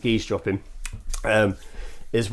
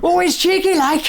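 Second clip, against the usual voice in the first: very high, stretched mock Northern squeal; hammed-up Northern accent, like an advert voice-over; cheeky Northern girl; slower than usual. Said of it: "I sound like the guy who does".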